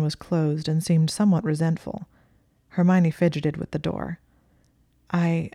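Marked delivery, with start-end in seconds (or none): 5.1-5.5 hesitant false start; a touch of nervous faltering; nervous stutter. A narrator's reading. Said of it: "she stuttered nervously".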